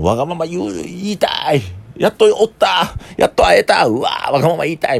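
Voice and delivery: put-on high, childish whine, gleeful at finally finding him; whiny grudge-holder. Bitter ex-customer finally corners him, high childish whine. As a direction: gush like a spoiled kid who finally caught his target. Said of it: "ふつふつムカついてて僕の顔見たら".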